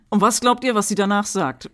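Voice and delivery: usual speaking voice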